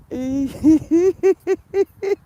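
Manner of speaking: loud, high rolling giggles; giggling fit. Real, not acted. Laughing fit.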